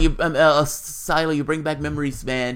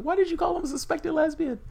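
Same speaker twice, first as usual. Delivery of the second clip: high, lightly voiced baffled question; mock puzzled viewer; 13 semitones higher than usual. Voicing viewers questioning his jab, lightly mocking high tone. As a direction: ask it in a higher mock-confused voice. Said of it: "People was like".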